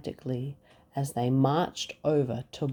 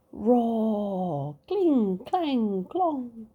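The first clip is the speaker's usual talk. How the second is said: high roar and clangs; battle noises; 7 semitones higher, much slower than usual. Acted. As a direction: ring the clashes out high. Battle sounds again.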